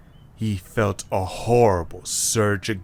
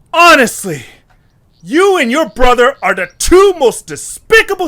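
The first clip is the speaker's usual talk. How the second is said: high, loud angry yelling; furious shout; 12 semitones higher, 11 dB louder than usual. Acted. Eche still raging at Taisene, loud furious yelling. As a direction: keep hammering her with fury.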